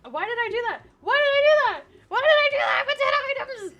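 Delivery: high frantic scream of disbelief. Real, not acted. Gamer screams at himself after a risky play, high frantic shriek.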